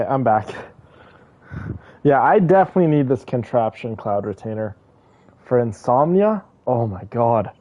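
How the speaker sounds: usual speaking voice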